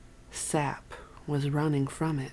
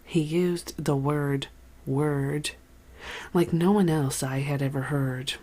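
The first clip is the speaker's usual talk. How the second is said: usual speaking voice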